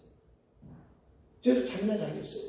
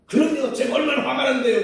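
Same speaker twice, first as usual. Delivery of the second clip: indignant retort; 4 semitones higher, 7 dB louder than usual. Retold or imitated. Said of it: "retorting to God indignantly".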